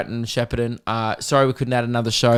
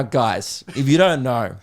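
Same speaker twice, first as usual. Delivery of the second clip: normal talking voice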